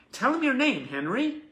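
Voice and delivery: put-on high, loud coaxing of a child; prompting parent. Mother prompts silent son to give his name, coaxing voice.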